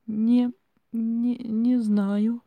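high, slow, wailing stammer; loud, drawn-out frightened whine; moaning stammer. A narrator's reading. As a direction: moan it haltingly in fright. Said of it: "простонала я".

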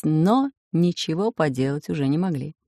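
usual speaking voice

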